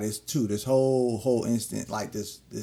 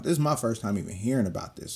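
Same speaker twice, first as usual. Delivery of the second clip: ordinary talk, nothing marked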